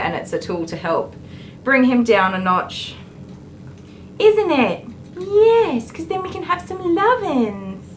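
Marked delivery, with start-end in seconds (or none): 4.2-7.8 high dog talk. Real, not acted. Groomer cooing to the dog about cuddles.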